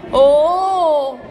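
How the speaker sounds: loud, high, long "Oh!"; surprised oh. Acted. Ranocchio hears the road goes up there, surprised. Frog learns they must climb.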